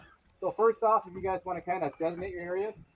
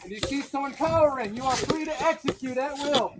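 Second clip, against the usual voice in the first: stern slow commanding officer; drill sergeant; 8 semitones higher, 8 dB louder, slower than usual. Acted. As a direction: lay down the law to recruits. Commander orders in character.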